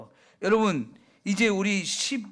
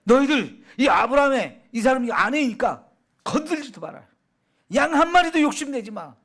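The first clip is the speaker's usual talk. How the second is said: higher and louder royal order; loud command; 6 semitones higher, 5 dB louder than usual. Acted. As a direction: bark the order at the whole court. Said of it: "막 크게 명령을 내렸어요".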